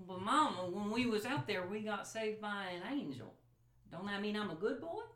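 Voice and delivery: put-on high, hopeful child's voice; young son pleading with his angry mama, high and hopeful; pleading little boy. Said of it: "he says".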